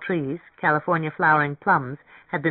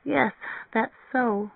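small, high, let-down admission; dismayed; 7 semitones higher, 3 dB quieter, slower than usual. A narrator's reading. Mary Ann admits the deposit is needed, dismayed. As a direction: concede it in a high little voice.

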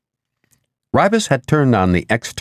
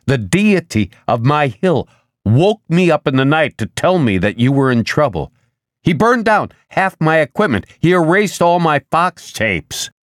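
higher-pitched, emphatic complaint; agitated; 8 semitones higher than usual. A narrator's reading. Man reporting what a deity did to him, agitated. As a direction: pile up the grievances, pitch climbing.

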